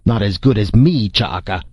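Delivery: understated indignant challenge; mildly angry boast. A narrator's reading. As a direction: boast with a touch of anger. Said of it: "He shook his hammer angrily".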